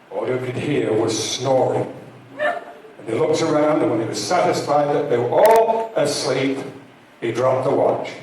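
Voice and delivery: usual speaking voice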